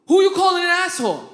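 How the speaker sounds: loud, high confrontational demand; angry challenge. Retold or imitated. Insulted driver walking up to the car, acted angry challenge. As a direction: square up with an indignant question.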